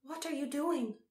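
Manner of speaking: high-pitched startled question; exclaiming. A narrator's reading. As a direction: exclaim it in disbelief. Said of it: "exclaimed Malin".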